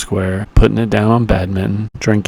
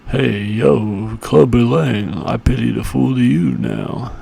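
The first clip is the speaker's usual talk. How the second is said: Rocky Balboa impression. Rocky taunts beaten Clubber Lang.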